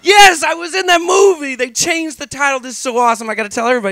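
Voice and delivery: high, loud, gleeful shout; excited shout. Retold or imitated. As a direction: shout it with sheer delight. Gleeful realization.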